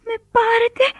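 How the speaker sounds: high, weak puppet voice; barely alive, a thin high plea; faint voice. A narrator's reading. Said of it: "με σβησμένη φωνή". (αδύναμη ψιλή φωνούλα)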